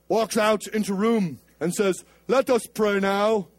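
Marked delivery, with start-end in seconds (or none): 2.3-3.5 mock-solemn British accent, slow and sustained. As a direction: intone the prayer in a put-on British voice. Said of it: "you have to do it in British accent".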